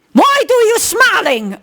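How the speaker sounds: high, slow mock-aggressive challenge; imitated aggression. Retelling the man confronting Marcel at the checkout, imitated aggression. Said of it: "The energy".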